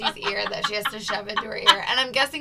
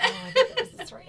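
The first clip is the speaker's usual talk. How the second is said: fast, high, laughing through the words; 14 semitones higher, much faster than usual. Real, not acted. Host laughing at her co-host's correction. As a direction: blurt it out mid-laugh.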